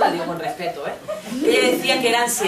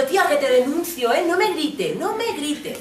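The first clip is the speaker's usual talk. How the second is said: indignant warning; 8 semitones higher, 3 dB louder than usual. Acted. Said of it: "que me pega estos gritos".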